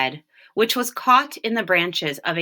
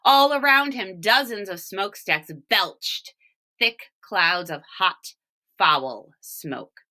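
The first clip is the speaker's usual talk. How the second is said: ordinary delivery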